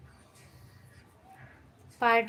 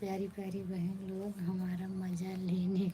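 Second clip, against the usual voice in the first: softer, breathy whisper of a comment; whispered aside; 4 semitones lower, 10 dB quieter than usual. Acted. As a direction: hush it like a secret tease. Reading a teasing comment aloud. (धीमी फुसफुसाहट में पढ़ना)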